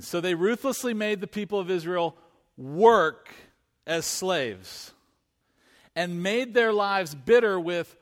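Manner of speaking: a gently weighted scripture read. Acted. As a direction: read the verse deliberately to the congregation. Reading scripture aloud.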